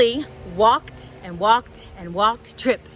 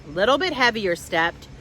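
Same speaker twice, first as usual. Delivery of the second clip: ordinary delivery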